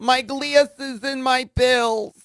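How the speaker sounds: put-on high, slow old-lady whine; fretful old lady looking for her things. Repeats old lady bit.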